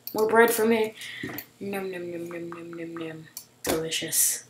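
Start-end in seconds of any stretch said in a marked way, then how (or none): 1.6-3.3 mock eating. Eating bread in game.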